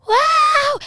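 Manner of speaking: high, loud shouted wow; cheering her friend's news with a loud mock wow. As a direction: yell it like a cheering fan. Hyping the news.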